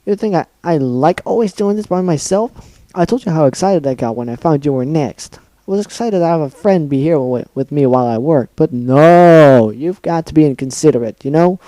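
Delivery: loud, rising furious rant; angry rant. Acted. Pinkie ranting at Dash.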